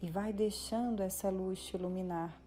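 guided meditation. Real, not acted. Meditation guide leading listeners up into the light, calm guided meditation. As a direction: ease the listener through each step, drawing out the breathing cues.

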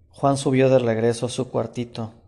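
usual speaking voice

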